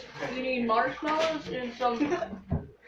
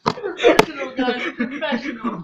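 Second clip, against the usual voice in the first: laughing; 9 dB louder than usual. Real, not acted. Mock praise of a messy float.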